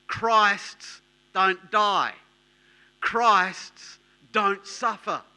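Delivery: protesting Peter. Acted. Peter argues with Jesus.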